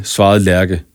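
plain reading voice

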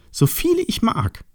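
very high, gleeful boast; jubilant. Acted. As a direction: crow it joyfully up high. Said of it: "jubelte das Tier".

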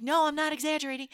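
high, fast protest; insistent. Retold or imitated. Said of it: "I'm like".

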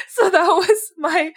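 slow, laughing through "So that was my". Real, not acted. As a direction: laugh the words out. Laughing at her story.

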